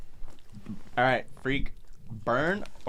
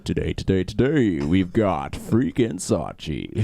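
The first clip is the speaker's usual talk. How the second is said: low, loud, slow radio host; 5 semitones lower, 6 dB louder, much slower than usual. Pretend. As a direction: drawl it like a smooth DJ. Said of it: "Why does it sound like you do".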